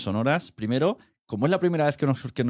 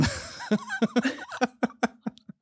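high burst of laughter; 3 dB quieter than usual. Real, not acted. Laughs at Tinder remark.

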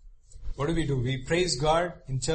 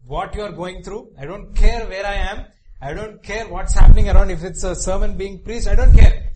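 put-on childish demand; 3 semitones higher, 12 dB louder than usual. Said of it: "You know, the kids cry".

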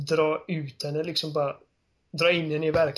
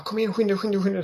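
higher, louder, eager hurry-up call; excited urging; 5 semitones higher, 4 dB louder, faster than usual. Retold or imitated. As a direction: urge him on like an excited kid. Ellie urging Joel to hurry.

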